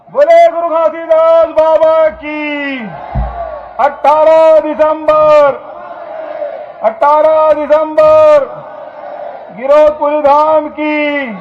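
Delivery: loud, high, drawn-out rally chant; stretched-out rallying cries at full voice; slogan shouting. Real, not acted. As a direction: shout each slogan slowly for the crowd to answer. Leads crowd in slogans.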